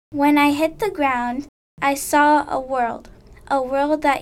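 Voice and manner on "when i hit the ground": plain reading voice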